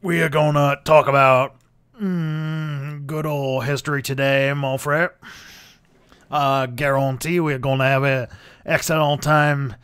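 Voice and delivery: put-on slow, drawn-out Cajun accent. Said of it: "just do a video in a Cajun accent".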